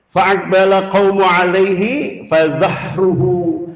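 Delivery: slow chanted recitation of the hadith. Acted. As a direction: intone the Arabic slowly. Recites Arabic hadith text.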